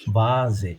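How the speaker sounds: "বা জে" in two syllables, S voiced as Z. Shown as a demonstration. Teacher explains S before a vowel, Z sound. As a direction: model "বা জে" with Z starting the second syllable. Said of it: "জ হচ্ছে".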